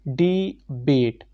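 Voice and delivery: डी बेट said very slowly, syllable by syllable; split into syllables. Shown as a demonstration. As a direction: draw out डी and बेट as two separate beats. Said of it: "दो syllable का एक word".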